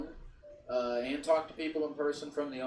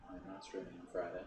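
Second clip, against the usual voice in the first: much softer and lower; hushed repeat; 6 semitones lower, 12 dB quieter than usual. Real, not acted. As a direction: repeat it under your breath, almost to yourself. Repeats answer, dropping away.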